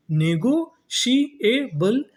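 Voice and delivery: slow syllable by syllable. Shown as a demonstration. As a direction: sound it out slowly for students. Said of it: "तो पूरी meaning क्या बनी". (धीरे-धीरे, ऊँचे स्वर में)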